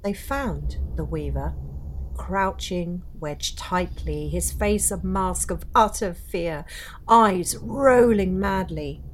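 dark storyteller voice, building tension; ghost story narration. A narrator's reading. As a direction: tell it like a campfire ghost story.

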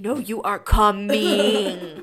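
loud, drawn-out order; hammed-up stretched-out command; emphatic insisting. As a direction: insist slowly and loudly, leaving no argument. Acts out insisting mom come.

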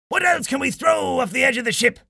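high, urgent pirate call; captain's shout. A narrator's reading. Old Jim asks the crew what to throw, shouting. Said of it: "shouted Old Jim".

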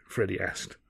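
ordinary delivery, nothing marked

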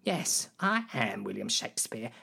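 usual speaking voice

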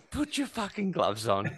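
high-pitched mock-furious command; retold angry order. Nathan storming into the opponent's changing room, retold angry order. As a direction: order him about, acting out the storming in. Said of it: "Nathan storms into the guy's changing room".

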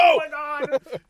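high, loud amazed cry; playful thrilled exclamation; excited fan shouting. Retold or imitated. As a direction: join in the cheering at full voice.

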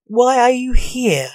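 a touch of indignant challenge; demanding. A narrator's reading. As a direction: demand an answer, voice lifted. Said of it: "he demanded".

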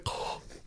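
noisy Darth Vader-like breath; imitated snorting breath. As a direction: snort air in like a pig. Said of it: "He's like Darth Vader".

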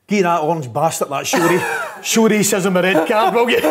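can't get the punchline out for laughing; cracking up retelling a teammate's joke; laughing through quote. Retold or imitated. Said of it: "he goes".

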